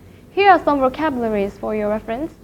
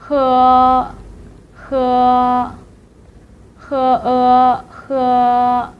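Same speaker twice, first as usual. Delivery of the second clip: "he" drawn out on one level pitch, split as "he e"; first tone; slower than usual. Shown as a demonstration. Teacher drills the word for drink, long level "he". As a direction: hold "he" steady like a sung note. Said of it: "here are some vocabularies".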